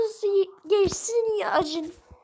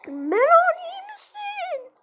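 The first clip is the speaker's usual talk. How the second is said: much higher, slow cartoon friend voice; slow high character voice; 12 semitones higher, 3 dB louder, slower than usual. A friend asks Melon if he is fine, slow and high. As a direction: ask gently in a put-on squeaky voice.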